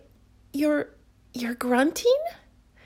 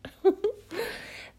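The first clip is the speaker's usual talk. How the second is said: flustered giggle; 12 semitones higher than usual. Acted. Giggles after the kiss.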